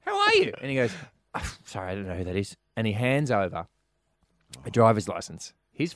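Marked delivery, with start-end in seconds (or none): 0.0-0.5 very high, chirpy greeting; sunny, friendly. Acted. Teller catching up with an old schoolmate, sunny. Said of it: "she was a really sunny, friendly person". 1.4-2.5 softer, offhand denial; feigned ignorance. Acted. Nervous customer denying he knows the teller, feigned ignorance. Man feigns not knowing her.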